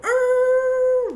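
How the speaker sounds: very high, loud sustained "arr"; one long piercing mimicked cry; imitated long howl. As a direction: hold a high, loud wail. Imitates the noise they made.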